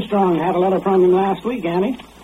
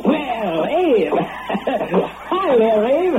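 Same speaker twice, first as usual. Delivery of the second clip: laughing, hearty welcome; delighted greeting; 4 semitones higher, much slower than usual. Acted. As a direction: greet a long-lost friend with a laugh. Friend greets returning man.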